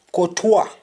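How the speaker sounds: "courtois" said ko-TWA, silent s; French pronunciation. Shown as a demonstration. Teacher showing the French form, "courtois" as ko-twa. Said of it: "And the French say courtois".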